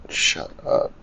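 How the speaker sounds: whispered, under the breath; barely voiced, very quiet aside; muttered retort. Real, not acted. Man talking back to himself at the form, whispered.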